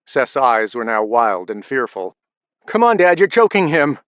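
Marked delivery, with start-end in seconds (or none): 2.6-4.0 high, loud desperate plea; yelling. A narrator's reading. Derek yells at father choking his brother, desperate. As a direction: shout for him to stop. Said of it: "Derek yelled".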